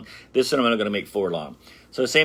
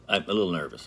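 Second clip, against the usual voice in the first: usual speaking voice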